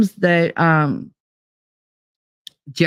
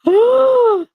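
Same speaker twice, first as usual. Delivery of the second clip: surprised gasp; 3 dB louder than usual. Retold or imitated. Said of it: "Marty was like".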